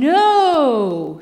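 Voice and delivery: loud, curt ogre no; Shrek's firm no. Retold or imitated. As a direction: shut him down as the ogre. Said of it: "Shrek says".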